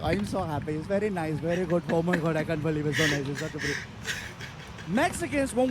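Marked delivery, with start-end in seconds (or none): 0.0-3.8 put-on cheerful Indian accent. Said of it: "it's all the time".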